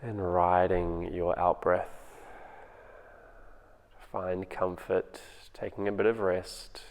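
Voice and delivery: calm, unhurried meditation voice with long pauses; guided meditation. Acted. Teacher guiding home listeners through their breathing, in a calm meditation voice. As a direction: ease them into the breath, gently.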